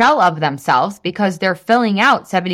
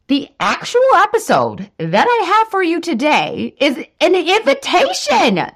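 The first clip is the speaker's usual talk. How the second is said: higher, drawn-out teaser; excited announcement; 9 semitones higher than usual. Real, not acted. To podcast listeners, announcing an exciting invitation. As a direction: build it up like a big reveal. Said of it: "That's so exciting".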